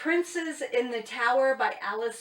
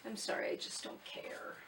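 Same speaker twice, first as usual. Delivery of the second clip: whispered aside; 11 dB quieter than usual. Real, not acted. Aside about a dull book blurb.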